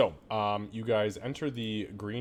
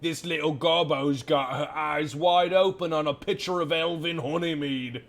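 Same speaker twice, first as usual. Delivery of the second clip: louder, higher announcement to the whole tavern; barkeep announcing to room; 6 semitones higher, 6 dB louder than usual. Acted. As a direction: call out to the crowd like a showman. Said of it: "Ladies and gentlemen".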